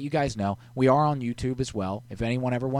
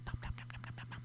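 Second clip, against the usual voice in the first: whispered nom nom; 18 dB quieter, much faster than usual. Pretend. Whispered eating noises.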